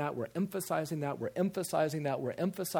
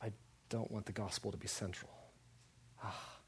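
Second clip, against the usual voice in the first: very soft, low mock confession; mock objector; 5 semitones lower, 11 dB quieter than usual. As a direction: say it small and hushed, parodying a critic. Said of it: "what does that make you sound like".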